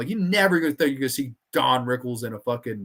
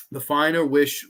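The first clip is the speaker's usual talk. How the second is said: normal talking voice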